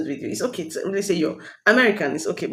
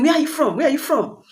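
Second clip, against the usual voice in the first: higher-pitched imitation of repeated questioning; mock a persistent questioner, pitched up; insistent questioning; 8 semitones higher than usual. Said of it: "You s-, you keep asking them".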